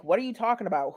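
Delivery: usual speaking voice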